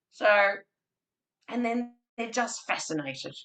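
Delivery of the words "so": ordinary talk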